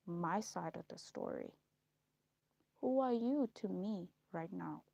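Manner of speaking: ordinary delivery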